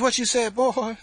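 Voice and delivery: loud, high singsong challenge, imitating the aunt; mock an auntie's sung-out scolding. Aunt confronting nephew about cussing, loud mock singsong. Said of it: "they all talk like they singing".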